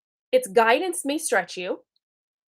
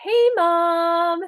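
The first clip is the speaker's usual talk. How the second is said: sweet greeting; 8 semitones higher, 4 dB louder than usual. Retold or imitated. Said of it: "So if you're just like".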